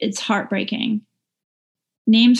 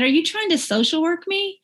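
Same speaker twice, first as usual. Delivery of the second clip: higher, guarded mock husband voice; husband's wary question; 8 semitones higher than usual. Husband answering his wife's question, lightly voiced suspicion. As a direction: lightly play the husband pushing back. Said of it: "And he, he said".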